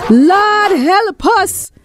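high, drawn-out cry of alarm; panicked scream. Acted. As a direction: cry out as your friend falls. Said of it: "screamed Bello".

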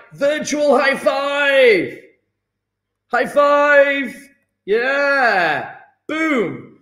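high, slow, drawn-out cheer; excited cheer. Real, not acted. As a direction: cheer it out big. Cheering a student.

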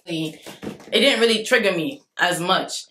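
ordinary talk, nothing marked

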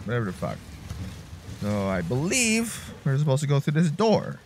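normal talking voice

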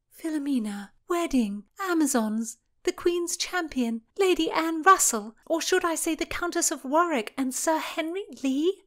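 high, loud incredulous questioning; disbelief. A narrator's reading. Constance realizing who wrote the letters, incredulous. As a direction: pile it up in rising disbelief. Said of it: "Constance asked in disbelief".

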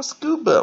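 "scuba" with the "scu" hit high and loud; stress on first syllable. Shown as a demonstration. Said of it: "看重音是在第一个音节". (重音在 "scu")

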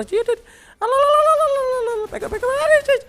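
very high, loud wailing of Allah; panicked shrieks. Real, not acted. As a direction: scream in panic as you get shot. Panicking under fire, crying out. (গুলির মুখে তীক্ষ্ণ আতঙ্কিত চিৎকার)

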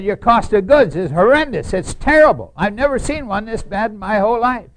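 loud, high, emphatic scolding; forceful verdict. Retold or imitated. Harry tells the board their costs are terrible, loud. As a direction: hammer the bad news home.

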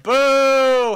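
long, loud jeer; drawn-out disapproving boo. Real, not acted. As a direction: boo it like a heckler. Booing the game.

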